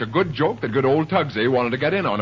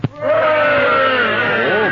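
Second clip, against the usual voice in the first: indignant oh; 12 semitones higher, 9 dB louder than usual. Acted. Ordered to stand in corner.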